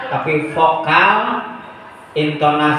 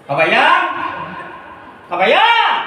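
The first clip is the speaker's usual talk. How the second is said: bellowing father; 6 semitones higher than usual. Acted. Father shouting for Kabayan, high bellowed call. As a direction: holler the name, voice leaping up. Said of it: "bapana ngagorowok".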